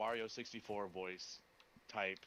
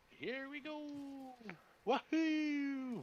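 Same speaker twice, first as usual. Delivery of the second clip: high, slow Mario squeal; wahoo yell; much slower than usual. Retold or imitated. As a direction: belt it out like Mario.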